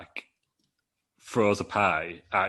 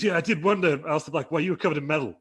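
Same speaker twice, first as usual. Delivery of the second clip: mock Kevin Owens; 8 semitones higher, 4 dB louder than usual. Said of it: "Kevin Owens comes in and goes".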